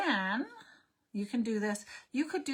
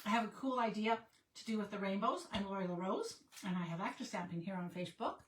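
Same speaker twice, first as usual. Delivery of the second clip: normal talking voice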